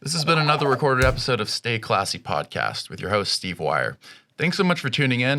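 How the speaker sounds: polished announcer sign-off; podcast outro read. Acted. Host thanking listeners and sponsor, announcer-style sign-off. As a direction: deliver a scripted show outro to listeners.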